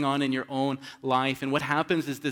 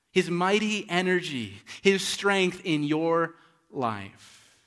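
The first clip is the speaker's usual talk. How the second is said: fervent preaching; 5 semitones higher, 7 dB louder than usual. Acted. Preacher at full force.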